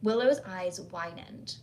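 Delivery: ordinary delivery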